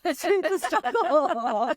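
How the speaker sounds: joking about her own struggle, laughing. Real, not acted. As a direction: laugh out the words "it's a struggle". Repeats line laughing.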